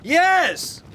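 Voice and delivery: loud insistent hail; shouting. Acted. Captain urges the stranger aboard, loud high shout. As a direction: shout the order high across the ice.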